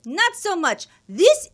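high, loud outraged rant; hammed-up husband berating the nurse; indignant man yelling. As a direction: play the busybody stranger demanding help at the top of his voice. Husband scolds his wife.

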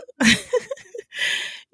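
squealing high laugh; real laugh after the riff. Real, not acted. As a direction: burst into a high giggle.

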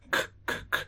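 quiet unvoiced k, k, k; voiceless k clicks. Shown as a demonstration. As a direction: tap out whispered k sounds. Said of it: "¿Cuál es el último sonido?". ("k, k, k" sin voz)

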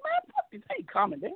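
mildly worked up, replaying an argument; refusing to calm down. Telling friends off who urge calm, retold heated refusal. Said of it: "come on, calm down".

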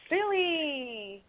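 high, delighted call of his name; excited greeting. Real, not acted. Host welcomes Billy onto the show, high delighted call.

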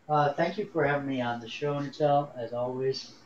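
faint, distant voice away from the mic; talking from across the room, off-mic; far from mic. Real, not acted. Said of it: "It sounds like you're far away".

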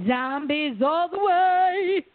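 slow, emphatic and eager; drawn-out enthusiasm. Real, not acted. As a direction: stretch it out with relish.

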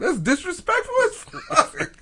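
laughing outrage. Real, not acted.

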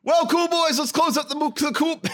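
high, far-off host sign-off; announcer voice. Acted. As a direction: call out the sign-off like a showman. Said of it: "show sign-off voice".

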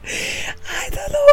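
giggling whisper, trailing off; whispered laughing. Real, not acted. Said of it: "whispering in embarrassed laughter".

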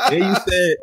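high-pitched kid-like mocking voice. Podcaster mocking a battler on camera with [name], kid-like voice. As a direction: mimic him like a pestering little kid. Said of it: "that's how he sound".

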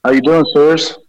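echoey voice like in a bathroom; bathroom reverb. Real, not acted. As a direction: voice with lots of room reverb. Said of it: "You sound like you're in a bathroom".

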